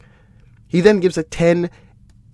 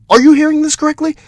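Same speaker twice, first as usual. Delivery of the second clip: high, loud, fast disbelief; can you believe this; incredulous; 12 semitones higher, 7 dB louder, faster than usual. Real, not acted. Host asking viewers in disbelief about a scorecard, high and loud. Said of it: "disbelief at the scorecard".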